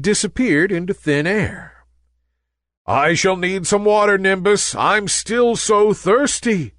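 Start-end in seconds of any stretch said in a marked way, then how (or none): 2.9-6.7 thirsty sun. A narrator's reading. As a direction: drag out the thirst. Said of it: "said the sun".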